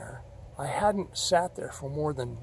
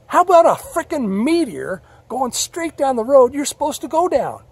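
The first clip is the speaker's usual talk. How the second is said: loud, high, sarcastic scolding; a fed-up God mocking the thick-skulled driver; 9 semitones higher, 11 dB louder than usual. God mocks Scott for missing the sign, loud and high. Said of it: "He says".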